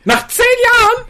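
high, loud, ranting dictator parody; hammed-up shouting dictator rant; Hitler impression. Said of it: "Ich hab Hitler nachgemacht".

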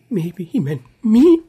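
"me" squeaked very high and drawn out; slow, loud mock plea peaking on "me"; exaggerated hopeful stress. Co-host mimicking Martha's line, exaggerated high "me". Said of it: "da sprach Hoffnung mit".

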